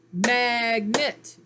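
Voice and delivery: MAGNET loud, in two stressed beats; clapped-out syllables. Shown as a demonstration. Teacher claps the word out with pupils, syllable by syllable. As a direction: punch MAG and NET as separate drawn syllables. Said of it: "Clap that word out".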